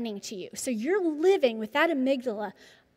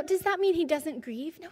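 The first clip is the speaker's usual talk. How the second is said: ordinary talk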